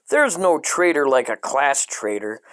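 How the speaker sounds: normal talking voice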